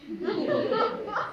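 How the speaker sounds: sudden high fairy giggle, trailing down; a gleeful impish laugh over the sleeper; high mischievous laugh. Acted. Puck laughs at sleeping maiden.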